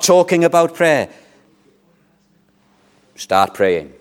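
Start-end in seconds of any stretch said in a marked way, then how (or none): none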